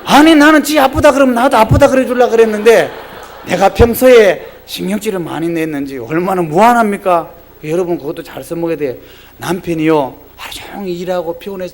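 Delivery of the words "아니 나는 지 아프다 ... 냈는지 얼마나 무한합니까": normal talking voice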